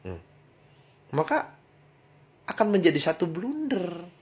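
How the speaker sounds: normal talking voice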